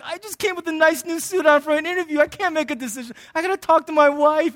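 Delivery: put-on whining excuse; protesting candidate. Acts out a stalling job candidate.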